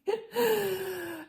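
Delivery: high-pitched embarrassed giggle. Real, not acted. Laughing at herself after retelling her meltdown, high giggle. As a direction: laugh it off in a squeak.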